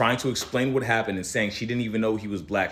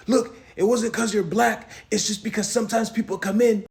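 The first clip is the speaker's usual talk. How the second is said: mock worker excuse; 12 semitones higher, 3 dB louder than usual. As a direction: drag out a fake excuse.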